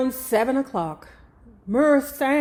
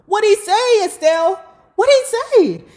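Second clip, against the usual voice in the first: a much higher, louder frantic shout; 11 semitones higher, 7 dB louder than usual. Retold or imitated. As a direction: shout it from on top of her bed. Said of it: "started shouting".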